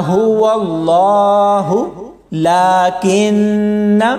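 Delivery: very slow chanted Quranic recitation. Shown as a demonstration. Teacher demonstrating connected reading, slow chanted recitation. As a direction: chant it slowly as in tajweed. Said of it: "तो फिर ऐसे पढ़ा जाएगा".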